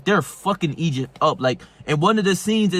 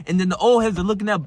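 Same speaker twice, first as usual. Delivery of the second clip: usual speaking voice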